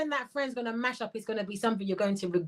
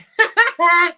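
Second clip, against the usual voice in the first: loud, high burst of laughter; sudden high cackling laugh; 10 semitones higher, 11 dB louder than usual. Real, not acted. Host cracks up at a comment she read, loud laughter.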